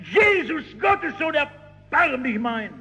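high pleading cry, slowing at the end. Retold or imitated. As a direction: plead at the top of the voice. Beggar keeps crying out.

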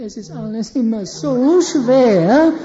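lauter, langsam, jammernd gezogen; weinerlich. Shown as a demonstration. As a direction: jammer wie einer, dem alles zu schwer ist. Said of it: "die haben so eine weinerliche Stimme". (weinerliche Stimme, langsam und lauter)

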